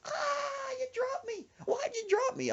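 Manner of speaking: very high mock cry of complaint; wounded wail. Dropped friend complains to rescuer, high imitated wail. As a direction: squeal it in hurt falsetto.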